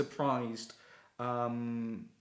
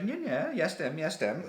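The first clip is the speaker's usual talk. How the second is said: high, rushed, insistent denial; flustered protest; 6 semitones higher than usual. Retold or imitated. At the post office, a high flustered protest to the clerk. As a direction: protest it in a panic. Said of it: "I was just like".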